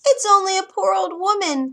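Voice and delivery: put-on the disguised queen squeaks like a harmless old woman; squeaky old woman. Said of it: "came a squeaky voice".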